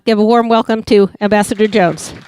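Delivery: ordinary talk, nothing marked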